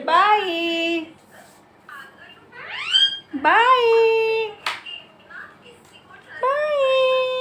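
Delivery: very high, long drawn-out cooing goodbye. Real, not acted. Mother waving bye to her pet parrot, squeaky high.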